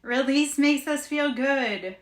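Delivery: "Release makes us feel good" stretched slowly, "makes" stressed. Shown as a demonstration. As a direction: draw it out as a slogan. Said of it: "Do you wanna say it like that?".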